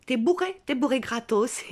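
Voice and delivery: high, loud, incredulous exclamation; amused outburst. Acted. Performer realising you're drunk for free, amused outburst.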